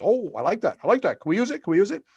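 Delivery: high, eager impersonation with repeated phrases; Lloyd imitation. Lloyd asking to use the tagline, eagerly imitated. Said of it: "And Lloyd says".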